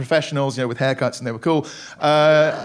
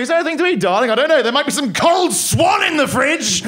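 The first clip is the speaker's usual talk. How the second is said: higher, louder hammed-up posh couple; posh toff parody; 8 semitones higher, 3 dB louder than usual. Rich couple chatting about breakfast, hammed-up posh voices.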